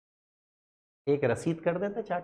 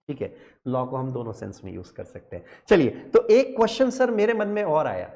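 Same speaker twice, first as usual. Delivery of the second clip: normal talking voice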